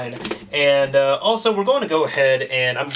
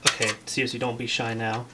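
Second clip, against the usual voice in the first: quiet coaxing; 4 semitones lower, 8 dB quieter than usual. Real, not acted. Coaxing the machine to boot.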